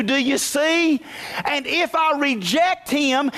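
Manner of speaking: rising pulpit fervor, drawn out; full-force preaching. Acted. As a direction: preach it out to the church.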